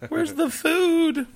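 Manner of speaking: very high drawn-out wail; mock wail. Hungry camper left without food, mock wailing. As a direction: stretch it out in a squealed mock plea.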